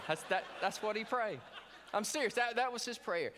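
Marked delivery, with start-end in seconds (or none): none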